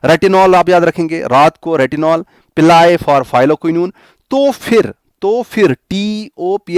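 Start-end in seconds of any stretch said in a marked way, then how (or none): none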